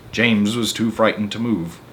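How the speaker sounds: ordinary delivery, nothing marked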